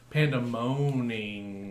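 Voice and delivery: Pandemoning said very slowly, stretched; drawn-out pun. Shown as a demonstration. Pitching a podcast name, slow stretched pun. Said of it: "pun drawn out".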